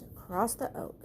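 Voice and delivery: ordinary delivery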